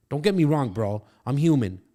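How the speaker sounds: usual speaking voice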